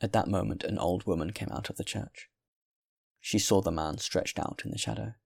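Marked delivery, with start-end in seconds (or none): none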